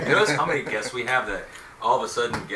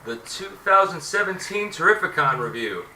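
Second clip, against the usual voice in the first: big, loud show opener; higher, louder podcast announcer; 7 semitones higher, 8 dB louder than usual. Acted. Opens the episode as host.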